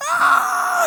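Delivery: high loud mock scream; aunt's scream imitated in a story; imitated wail. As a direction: belt a shrill mock wail. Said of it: "estaba gritando feo".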